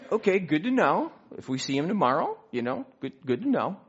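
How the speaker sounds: high, mock-cheerful "good to know"; wry acceptance. Told the host is really a bishop, answering with mock cheer. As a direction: play it ironically unbothered. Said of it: "I'm like".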